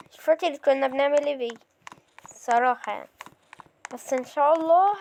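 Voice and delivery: ordinary talk, nothing marked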